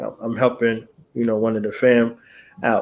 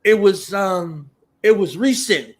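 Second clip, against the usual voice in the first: higher, louder vague fan; imitating a fan; 6 semitones higher, 4 dB louder than usual. Host mimics fan who cannot recall the show, insistent. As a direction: insist without details. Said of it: "they be like".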